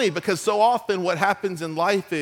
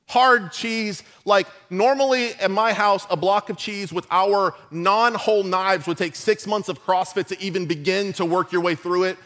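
usual speaking voice

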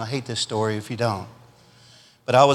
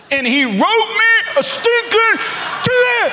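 mock outrage; 3 dB louder than usual. Real, not acted. Outrage at the ticket.

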